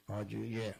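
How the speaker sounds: "A J J" said with low, grave vowels; low Yoruba sounds. Shown as a demonstration. Teacher repeating "A J J" to show the grave tones. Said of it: "Porque ele é todo com grave".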